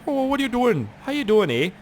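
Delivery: put-on high, cheery Canadian accent; hammed-up nice Canadian policeman; friendly Canadian cop. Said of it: "Canadian cops look all nice".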